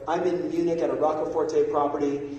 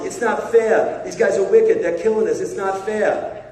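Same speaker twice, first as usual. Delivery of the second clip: louder, higher Boston tuna fisherman; aggrieved Boston-accented outburst; 4 semitones higher, 7 dB louder than usual. Retold or imitated. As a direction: whine about injustice in a Boston accent. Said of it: "Back to the tuna guy in Boston".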